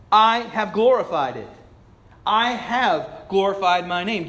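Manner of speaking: high, loud divine declaration; God speaking from heaven. Acted. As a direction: proclaim it slowly as God. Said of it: "God speaks from heaven".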